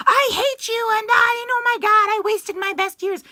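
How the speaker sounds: very high, strained mock screaming match; mock loud fighting. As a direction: imitate a bitter screaming row, hammed-up and high-pitched. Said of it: "never loud in the sense of".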